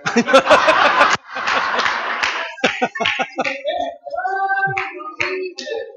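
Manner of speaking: high, drawn-out singing laughter; long sung laugh. Real, not acted. Preacher laughing at the returning mortician, long and high. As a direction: let the laugh ring out like a song.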